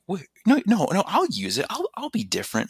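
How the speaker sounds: high, loud pleading protest. Retold or imitated. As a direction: insist you'll be different. Protesting friend's doubt.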